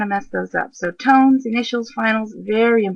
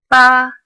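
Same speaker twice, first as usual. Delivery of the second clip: "ba" held high, loud and level; first-tone "ba", high and unwavering; 4 semitones higher, 10 dB louder than usual. Shown as a demonstration. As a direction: say "ba" on one high flat note. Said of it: "flat tone that is high and level".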